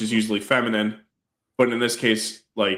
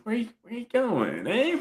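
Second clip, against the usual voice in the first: high, drowsy mocking voice; a lazy master teasing; sleepy kung fu master; 12 semitones higher, 5 dB quieter than usual.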